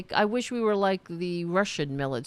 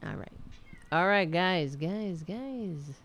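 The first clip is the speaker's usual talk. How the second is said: ordinary delivery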